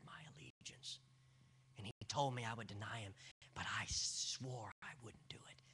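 quiet, intimate sermon narration; hushed storytelling. Acted. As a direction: draw the room in close. Hushed retelling, inner voice.

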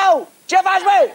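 high, loud shout of pain. Acted. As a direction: yelp and protest at the torturer. Acid poured on him.

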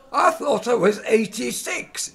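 put-on old, half-deaf man's high, slow shout; high-pitched, trembly old-timer at full volume; Alfie. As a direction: bellow it out slowly as a doddery northern old man. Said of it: "this is Alfie, uh, he's got the tremors".

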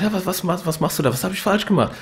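high, loud frantic pleading; desperate outburst. Retold or imitated. Alex pleading with his girlfriend in the car, frantic. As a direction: beg for an answer, losing it. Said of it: "rastet aus".

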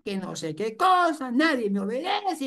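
high, put-on complaining mother voice; mock whiny mother. Mother grumbling that nobody obeys her, high mock whine. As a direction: whine like a sulking mom.

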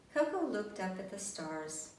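ordinary delivery

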